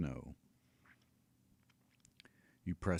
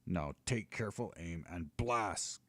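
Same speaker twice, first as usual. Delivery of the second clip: higher, louder giant nagging his dragon; a giant hectoring his pet; scolding giant; 4 semitones higher, 4 dB louder than usual. Acted. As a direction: push the dragon on like a gruff coach. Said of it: "cautions the giant".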